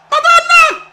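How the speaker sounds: very high, loud cry of amazement; astonished shriek. Real, not acted. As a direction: shriek it in disbelief. Stunned by Schwarzenegger's attack.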